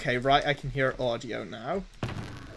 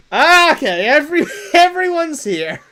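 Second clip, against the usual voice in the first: loud, high-pitched burst of excitement; excited shout; 12 semitones higher, 13 dB louder than usual. Real, not acted. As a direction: cry out as everyone turns up. Stream viewers suddenly all arrive.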